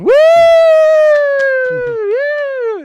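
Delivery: very high, loud held "woo"; crowd cheering. Retold or imitated. Sitcom crowd reacting to the joke, cheering whoop. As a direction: imitate a studio audience whooping. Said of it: "you got the crowd going".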